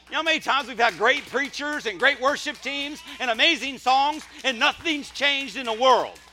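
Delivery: high, loud cry of exasperation; belted-out sermon complaint; impassioned preaching shout. Real, not acted. Preacher vents at congregation.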